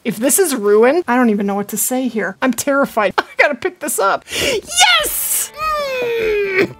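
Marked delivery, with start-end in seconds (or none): 4.3-6.8 very high, real joyful squeal; elated squeal. Real, not acted. Hunter seeing the diamond at last, squealing with joy. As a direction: squeal with triumph, then a high squealed hum.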